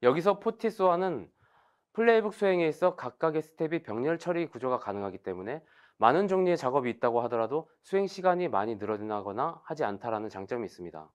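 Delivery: normal talking voice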